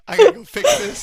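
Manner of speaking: laughing flustered. Real, not acted. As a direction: blurt it out while cracking up.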